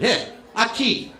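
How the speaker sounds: slow, drawn-out mock Spanish accent; Spanish said slowly with a put-on Spanish accent. Comic shows off his school Spanish in a mock Spanish accent. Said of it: "I still remember some of it".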